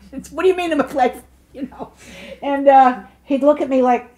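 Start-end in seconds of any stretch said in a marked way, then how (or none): 0.3-1.1 joking quip. Retold or imitated. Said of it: "they said I was so funny".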